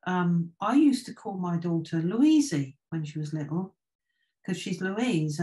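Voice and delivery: usual speaking voice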